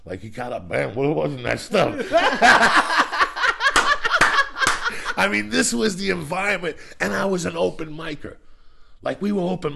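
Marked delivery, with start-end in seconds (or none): 2.0-5.1 high shrieking laugh. Real, not acted. Cracking up at the memory.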